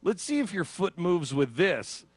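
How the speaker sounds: mock chirpy examiner; imitating medical staff. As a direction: play the staff cheerfully trying something on a patient. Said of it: "and going".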